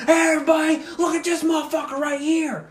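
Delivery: high, shouted talking-dog voice; dog raising alarm. Retold or imitated. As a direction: shout to the pack as an outraged dog. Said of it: "that dog lit me up".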